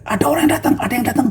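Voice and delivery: high, startled cry of men in a storm; alarmed disciples. Acted. Disciples in a boat at night, alarmed. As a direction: gasp it out as if seeing a figure on the water. Frightened disciples spotting a figure.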